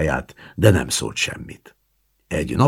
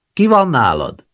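put-on high, louder old woman's question; grandmother demanding; 12 semitones higher, 7 dB louder than usual. A narrator's reading. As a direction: press him to answer. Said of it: "grandmother demands who is there".

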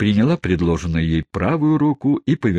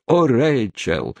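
passionate; 6 semitones higher, slower than usual. Acted.

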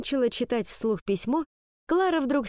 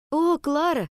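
sympathetic; 7 semitones higher than usual. Acted. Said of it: "искренне сочувствуя ей".

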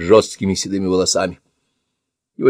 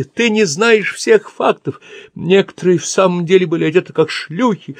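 furious raving; 12 semitones higher, 3 dB louder than usual. Acted. Old man raging at attacker.

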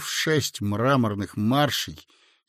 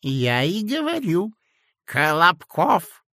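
higher, slow, a touch hurt; offended old man; 6 semitones higher than usual. A narrator's reading. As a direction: sound slighted, old man. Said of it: "обиделся старичок". (обиженный старичок)

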